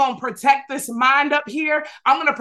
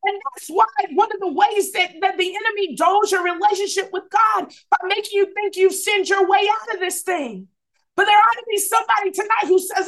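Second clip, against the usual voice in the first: high, driving preacher cadence; rising sermon fervor; full-force preaching; 6 semitones higher than usual. Acted. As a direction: preach it out to the congregation.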